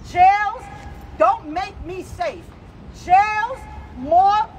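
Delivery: normal talking voice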